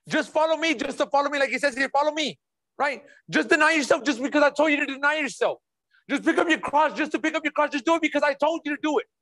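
mock bossy command. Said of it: "Jesus don't ever talk like that".

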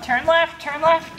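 ordinary talk, nothing marked

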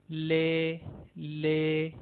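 "ले" like English L; letter L. Shown as a demonstration. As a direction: say "ले" clearly. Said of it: "English के letter एल के जैसी". ("ले" अंग्रेज़ी एल जैसा)

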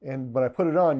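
ordinary talk

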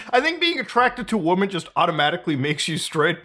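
normal talking voice

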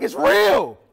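very loud, high outcry; the truth hits hard; loud shocked cry that it's real; stunned realization. Retold or imitated. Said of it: "and I was like".

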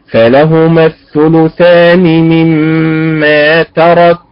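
فلهما stressed on the lam vowel, slow and measured; slow careful recitation of فلهما الثلثان; correct Quranic stress. Shown as a demonstration. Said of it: "فتنطق فلهما هكذا بهذا الأداء".

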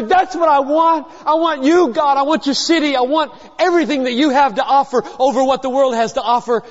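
rising fervour, fast by the end; fervent plea. Acted. Voicing a heart desiring God.

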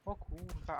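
ordinary talk, nothing marked

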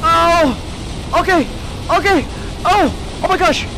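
short, high panicky exclamations; startled yelps. Real, not acted. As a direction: yelp at each near miss. Startled during driving set piece.

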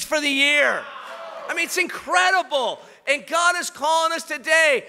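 loud, high, fervent sermon delivery; rally the congregation with passion; preacher at full volume, stirring the church; full-force preaching. Acted.

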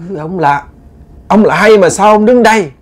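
high, fearful stammer at the dark figure; frightened question. Retold or imitated. Uncle stammering at the shadow, frightened and high. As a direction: ask who's there, scared stiff.

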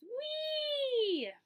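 high, loud excited "Wee!"; a rabbit's joyful high whoop; gleeful squeal. Acted. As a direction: squeal with delight like a bouncy rabbit. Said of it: "He says".

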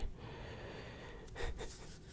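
soft breathy chuckle under the breath; a quick hushed laugh, keeping it secret; whispered laugh. Acted. Sly dealer roleplay.

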